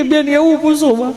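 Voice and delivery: hammed-up lump in the throat begging the nurse; choked-up plea. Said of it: "ઓલો ગળગળો થઈ ગયો". (ગળગળા અવાજે નાટકીય વિનંતી)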